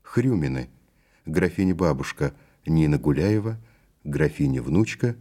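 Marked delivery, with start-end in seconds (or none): none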